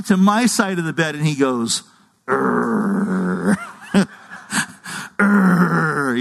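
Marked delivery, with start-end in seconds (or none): 2.3-3.6 low, drawn-out groan; imitated dog groan. Owner imitates his dog waking him at night, groaning. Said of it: "he just groans like that". 5.2-6.2 long whining groan like a dog; imitated dog groan. Owner imitates his dog asking to go out, groaning. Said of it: "he just groans like that".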